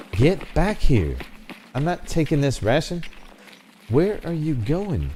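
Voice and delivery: loud, high calls to stop; shouting after him. Real, not acted. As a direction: yell after the fleeing Santa. Calling fleeing Santa.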